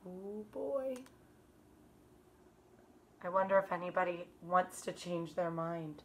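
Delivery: normal talking voice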